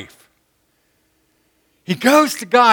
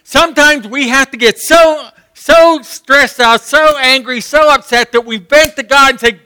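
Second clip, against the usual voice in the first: loud, higher, rising preaching; full-force preaching; 6 semitones higher, 5 dB louder than usual. Real, not acted. Preacher urging his church to vent, shouting. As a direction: build the congregation up to the vent. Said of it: "preaching at full force".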